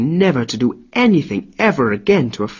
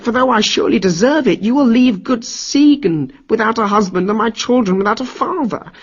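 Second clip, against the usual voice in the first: higher, whining plea; wailing and begging; 10 semitones higher than usual. Acted. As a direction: grovel through tears for your life. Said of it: "Sobbing like a baby, Loki wailed".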